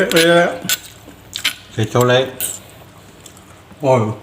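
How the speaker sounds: ordinary talk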